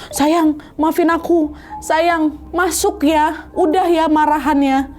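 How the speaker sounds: high, slow, pleading woman's voice; pleading wife. Acted.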